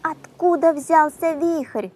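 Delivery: a slow, high call of fright; frightened cry. A narrator's reading. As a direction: cry out in alarm over the storm. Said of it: "закричала лососиха".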